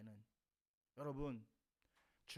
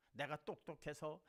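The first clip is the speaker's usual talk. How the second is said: ordinary delivery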